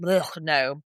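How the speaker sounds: usual speaking voice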